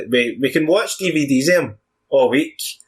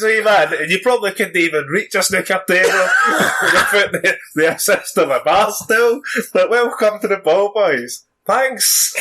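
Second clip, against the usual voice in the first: mock hobbit host; 6 semitones higher than usual. Welcomes Frodo in a put-on voice.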